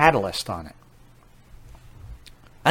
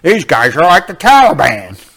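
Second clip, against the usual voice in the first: loud, sneering impression of an accuser; mock the accusers loudly; mocking opponents; 4 semitones higher, 9 dB louder than usual.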